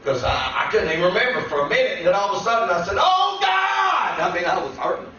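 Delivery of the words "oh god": retold cry to God. Injured man on the roadside calls out, anguished yell. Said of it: "But I yelled out".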